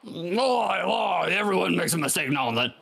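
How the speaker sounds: shouted Australian dolphin voice; Aussie accent. Acted. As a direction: bellow it in a broad Australian accent. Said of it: "Do your best".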